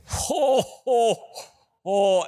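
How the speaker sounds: loud, high "ho, ho, ho" laugh; laughing out loud at his own story; big hearty laugh. Real, not acted. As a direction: burst out laughing at the punchline.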